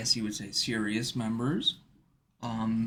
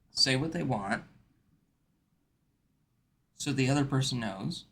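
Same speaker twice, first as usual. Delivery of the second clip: ordinary delivery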